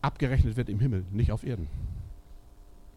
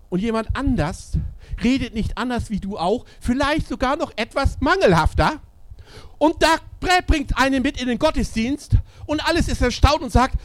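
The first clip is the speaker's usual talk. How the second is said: animated storytelling; 9 semitones higher, 6 dB louder than usual. Acted. Lively story of a convert.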